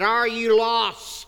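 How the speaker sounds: lightly voiced earnest evangelist. Evangelist asks stranger.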